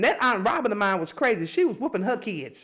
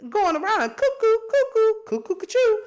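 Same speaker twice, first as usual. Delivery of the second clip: high, loud mocking "cuckoo, cuckoo" call; 12 semitones higher, 5 dB louder than usual. Mocking the man who thinks he is pregnant, loud and high. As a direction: sing out "cuckoo" like a crazy clock to mock them.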